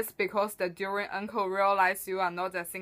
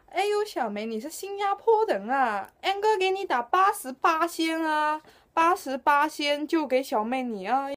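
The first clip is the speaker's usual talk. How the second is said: uncle voice; 5 semitones higher than usual. Acted. Durian uncle answers local.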